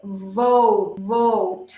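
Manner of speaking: "vote" with a strong English V, not a B; low, drawn-out "vote" with firm V; strong V sound. Shown as a demonstration. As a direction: lean on the V starting "vote". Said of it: "sonido fuerte".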